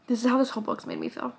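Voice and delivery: ordinary talk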